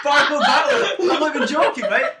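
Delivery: high, loud laughing reveal; giddy laughing announcement; excited laughing. Real, not acted. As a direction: shriek it out with glee.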